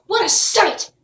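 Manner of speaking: usual speaking voice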